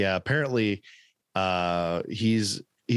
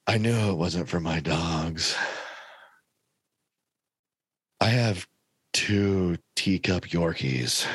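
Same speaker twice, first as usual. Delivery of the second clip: low, very slow drawling testimony; Johnny Depp slow; 3 semitones lower, slower than usual. Retold or imitated. Testifying to the lawyer, low slow Depp drawl. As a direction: drag every word out like a tipsy pirate. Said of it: "he took forever to say it".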